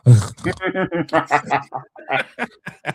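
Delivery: long real burst of laughter; helpless chuckling run; hearty laugh. Real, not acted. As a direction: crack up for a few seconds. Laughs after sleeping remark.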